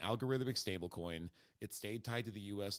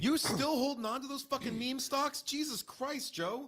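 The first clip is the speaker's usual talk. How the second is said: incredulous; 5 dB louder than usual. Real, not acted. Mocking friend over meme stocks.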